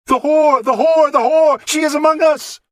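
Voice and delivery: high, loud shriek of the word; raving monk's shriek; frenzied shout. Acted. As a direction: scream it like a madman. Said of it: "he suddenly started shouting over and over".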